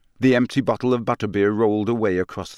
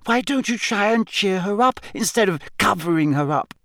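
put-on higher, impatient girl's voice; exasperated Hermione; 10 semitones higher than usual. A narrator's reading. Hermione impatiently challenges the house elves. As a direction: push back, fed up with the elves.

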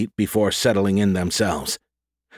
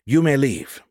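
higher-pitched formal dismissal; commanding; 5 semitones higher than usual. A narrator's reading. Joan dismisses the two guards, commanding. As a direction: dismiss them with authority in a raised pitch. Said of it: "she ordered, indicating the two guards".

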